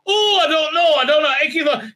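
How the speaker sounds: high, loud hammed-up cluelessness; mock clueless declaring. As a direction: declare your bafflement in a silly high voice. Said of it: "it's like she's stating the thing she doesn't know".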